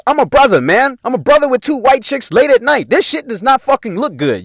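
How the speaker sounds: loud, high, exasperated warning; worked-up retold lecture; urgent scolding. Acts out urgent warning to friends.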